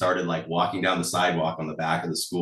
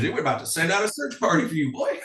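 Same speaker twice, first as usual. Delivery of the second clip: put-on Southern grandma accent; 9 semitones higher than usual. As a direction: tease him in grandma's backwoods Southern accent. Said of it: "a Southern act, like, a backwoods accent".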